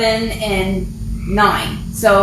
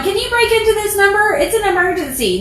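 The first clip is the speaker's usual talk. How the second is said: 1 mock teen caller; 9 semitones higher, faster than usual.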